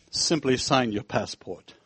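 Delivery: low, slow teasing voice; a hint of a smirking guard; sly guard. Guard teases the American with a smile, low and slow.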